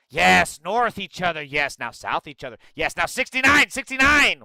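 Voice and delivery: over-the-top fake enthusiasm; mock excitement. Mock excited reply to chat message.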